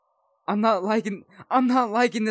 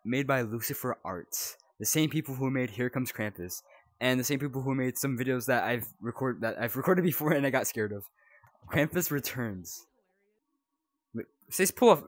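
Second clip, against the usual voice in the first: ordinary talk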